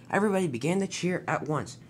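ordinary delivery

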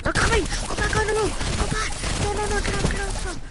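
high loud panicked yelling; panicking gamer. Real, not acted. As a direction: yell warnings in a panic. Zombies close in again.

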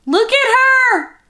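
high, slow coo at the doll. Real, not acted. To the doll she adores, a high slow coo. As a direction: melt over the doll, drawn out.

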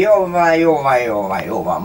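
loud, slurring reassurance fading down; loud drunk. A narrator's reading. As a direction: drunkenly wave it off. Drunk father, about to collapse.

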